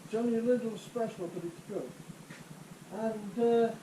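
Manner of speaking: muffled, as if through a mask. Real, not acted. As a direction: talk through a face mask. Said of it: "if you can hear my muffled voice".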